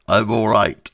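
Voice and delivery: malfunctioning robot voice. Pretend. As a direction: say it like a broken robot. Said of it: "I sound like a robot who's had ... a massive malfunction".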